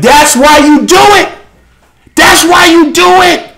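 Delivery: high, impassioned accusation from the pulpit; fiery preaching. Real, not acted. Preaches at full force.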